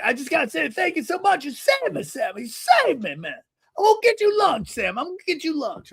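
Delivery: put-on high, effusive old-man impersonation; grateful older man. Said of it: "It's how he talks".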